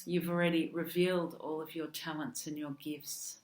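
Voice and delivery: usual speaking voice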